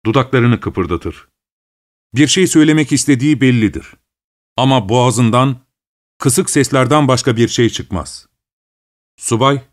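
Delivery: ordinary delivery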